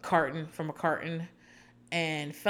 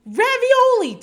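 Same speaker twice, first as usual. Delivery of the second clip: much higher, louder, bratty yelling son; mock angry son; 10 dB louder than usual. Sons mocked yelling at their mother, much higher. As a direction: yell it like a spoiled son at his mother. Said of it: "they're like".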